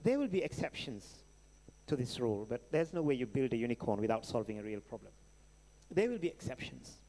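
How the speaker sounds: ordinary talk, nothing marked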